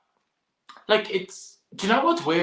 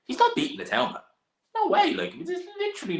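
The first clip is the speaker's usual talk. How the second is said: incredulous exclamation. Real, not acted. Insisting Whyte has no chance.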